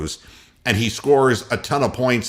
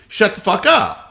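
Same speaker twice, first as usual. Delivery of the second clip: higher, sharp dismissive snap; angry retort; 9 semitones higher than usual. Real, not acted. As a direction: cut him off with contempt. Ranting at Steph Curry.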